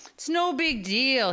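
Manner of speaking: lightly voiced injured father, breezy; dad's brave reply. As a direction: play down the injury cheerfully. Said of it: "he told Mister Denver".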